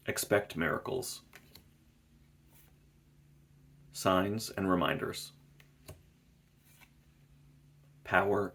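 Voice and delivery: gentle, unhurried card names; soft card reading. Acted. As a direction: read each card name softly, with space. Reads out card names, softly.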